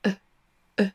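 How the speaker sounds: soft relaxed schwa "E", barely there; schwa sound. Shown as a demonstration. Demonstrating a very soft vowel, soft isolated schwa. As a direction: say "E" as a short neutral vowel with loose lips. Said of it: "Là, le son voyelle".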